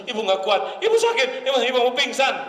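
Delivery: high, theatrical wife about to faint; overdramatic wife. Retold or imitated. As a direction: ham up a wife swooning for sympathy. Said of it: "ada istri yang lebay".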